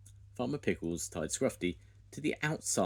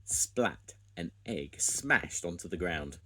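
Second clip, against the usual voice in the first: ordinary delivery, nothing marked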